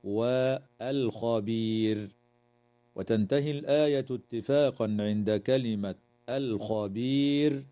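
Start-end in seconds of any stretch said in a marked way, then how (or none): 0.0-2.1 والخبير slow, the ل thin; light lam. Shown as a demonstration. Teacher isolating the word والخبير to show the light lam. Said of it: "ترقيق اللام".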